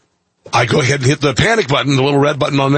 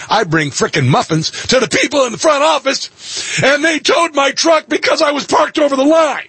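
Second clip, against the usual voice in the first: rising from high to much higher, outraged; building indignation; 12 semitones higher than usual. Real, not acted. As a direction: climb into disbelief. Indignant over towing.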